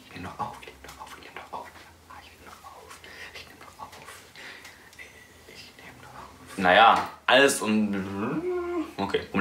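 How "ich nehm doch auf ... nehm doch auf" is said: whispering. Real, not acted. Whispering while recording.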